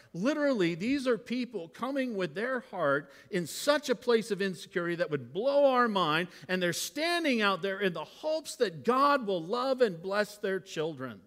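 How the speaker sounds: ordinary talk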